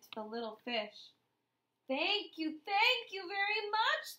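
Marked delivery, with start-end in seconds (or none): 1.9-4.2 high bubbly thank-you, rising; playful thanks. Acted. Little blue fish thanking Rainbow Fish for the scale. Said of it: "bubbled playfully".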